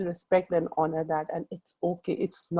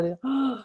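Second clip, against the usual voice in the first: mock gasp; 6 semitones higher than usual. Acting out a proposal reaction.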